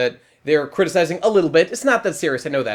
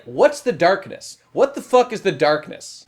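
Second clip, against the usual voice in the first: ordinary talk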